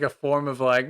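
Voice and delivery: ordinary talk, nothing marked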